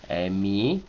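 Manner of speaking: "Emi" starting low and rising on "mi"; drawn-out "Emi" climbing from a low E; low initial accent. Shown as a demonstration. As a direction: begin low on the E, lift the end of "Emi". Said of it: "es con acento bajo".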